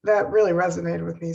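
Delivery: usual speaking voice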